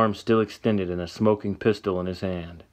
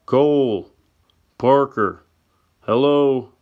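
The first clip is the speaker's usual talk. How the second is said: shouted names, higher and louder; calling out; 5 semitones higher, 6 dB louder, slower than usual. Acted. Sam yells to friends at the shack, calling out loud. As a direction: call out loud toward the shack. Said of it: "He turns back to the house and yells".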